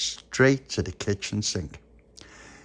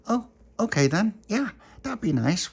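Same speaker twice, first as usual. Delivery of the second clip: high voice; 12 semitones higher than usual. Acted. Pauline answering the puppy.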